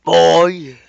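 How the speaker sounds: put-on loud high shout of Boy!. Shown as a demonstration. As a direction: bark Boy! across the room. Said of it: "I can do that better".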